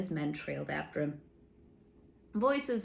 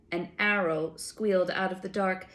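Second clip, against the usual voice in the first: ordinary delivery, nothing marked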